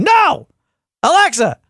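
high, drawn-out exasperated command; slow down and pitch it way up, fed up; really frustrated. Retold or imitated. Man to the wrong Alexa answering, really frustrated. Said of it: "I'm getting really frustrated".

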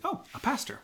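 high, light, serene voice; imitating a serene mother's calm reply. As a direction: say it as if it were obvious, calmly. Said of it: "she just says very, very calmly".

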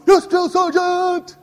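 loud clipped yes drill sergeant; barked military recruit reply. Retold or imitated. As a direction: snap back like a recruit.

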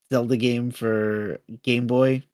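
ordinary talk, nothing marked

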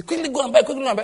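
playful imitation of panic buying; mock urgent. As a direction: egg them on to rush and buy. Mock urging to rush buying.

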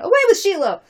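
high, loud rejection; crowd shout. Acted. Crowd throwing out a nominee, shouted. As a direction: shout the candidate down.

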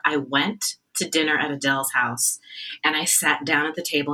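ordinary talk, nothing marked

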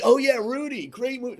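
high, bright mock enthusiasm; gushing listener. Listener imitated gushing over the movie, high excited. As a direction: gush like a fan who loves the film. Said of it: "Some of you listening are gonna be like".

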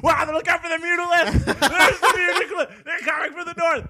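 high, loud mock commentator frenzy with laughter; really excited. Imitating own reaction to StarCraft casts, wildly excited. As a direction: shout it like a thrilled fan, hammed up. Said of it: "I would get really excited".

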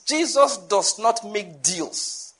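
each word set apart, slowly; slow emphatic statement. Shown as a demonstration. Preacher to congregation, slow and weighty. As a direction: spell it out slowly so it lands. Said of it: "I need to say that again".

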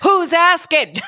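high, put-on street-tough challenge; mock tough guy. Co-host imitates Aladdin facing a stranger, mock tough. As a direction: play a little gangster demanding answers. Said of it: "He's all tough".

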